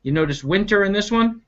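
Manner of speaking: plain reading voice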